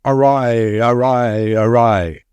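long drawn-out battle cry, held back; great voice crying out. A narrator's reading. Narrator voicing the great voice the soldier hears, drawn-out cry. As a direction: call it out slowly, each cry stretched. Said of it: "a shout louder than a thunder peal, crying".

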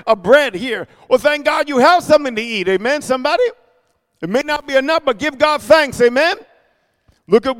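fervent preaching. Acted. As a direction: rouse the congregation. Preaching at full force.

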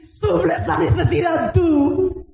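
full-force pulpit preaching; fiery preaching. Acted. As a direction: preach it out to the whole church.

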